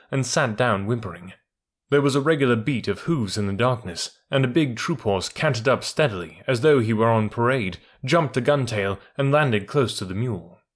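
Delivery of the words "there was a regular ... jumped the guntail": usual speaking voice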